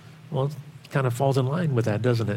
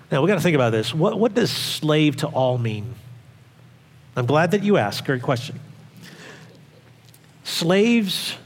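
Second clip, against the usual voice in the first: ordinary delivery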